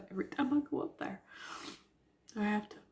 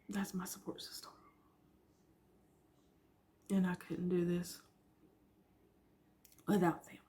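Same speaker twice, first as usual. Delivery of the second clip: teary-eyed. Real, not acted. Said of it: "I didn't mean to get all teary-eyed".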